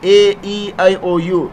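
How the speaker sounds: normal talking voice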